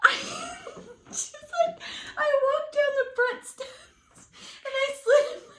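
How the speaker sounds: high breaking laughter; laughing hard. Real, not acted. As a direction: laugh so hard you squeak. Laughing too hard to speak.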